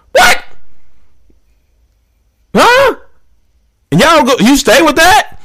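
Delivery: a high, incredulous What? then Huh?; stunned disbelief. Real, not acted. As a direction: react like you can't believe your ears. Disbelief at staying together.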